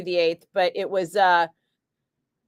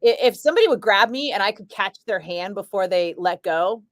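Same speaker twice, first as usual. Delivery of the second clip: ordinary talk, nothing marked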